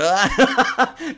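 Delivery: short bright laugh. Real, not acted. Vlogger laughing at her own cheeky remark, short laugh.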